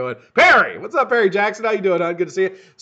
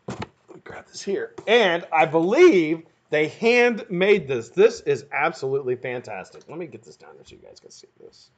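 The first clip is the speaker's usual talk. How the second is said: normal talking voice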